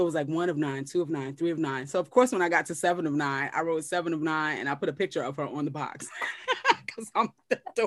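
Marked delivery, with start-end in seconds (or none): 6.1-7.9 laughing. Real, not acted. Laughing at own dorkiness.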